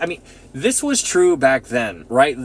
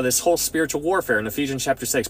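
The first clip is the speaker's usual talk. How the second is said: ordinary talk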